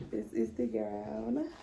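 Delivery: slow, hushed, emotional. Real, not acted. Showing viewers her graduation gown, hushed and slow. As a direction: breathe it out in awe. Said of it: "not trying to cry".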